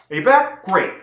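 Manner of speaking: high, loud, perky question; mock eager check-in. Vlogger greeting viewers back from watching, mock eager. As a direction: pitch it up, pretend they just returned. Pretends viewers have returned.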